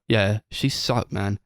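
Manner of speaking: ordinary talk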